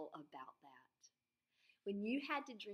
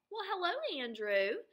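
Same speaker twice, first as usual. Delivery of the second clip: high, louder sing-song hello; a hint of a bright neighbor lady; lightly voiced friendly neighbor; 10 semitones higher, 7 dB louder than usual. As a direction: greet the boy like a cheerful neighbor lady. Neighbor greets Andrew at the door.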